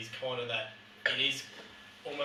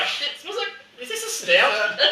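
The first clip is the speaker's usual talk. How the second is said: laughing; 12 semitones higher, 9 dB louder than usual. Retold or imitated. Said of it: "it was just like".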